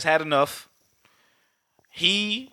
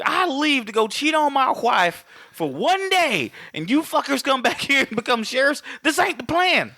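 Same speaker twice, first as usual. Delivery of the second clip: mock outrage, pitched way up and stretched out; indignant protest; 10 semitones higher than usual. Acted Wyatt Earp outrage, retold.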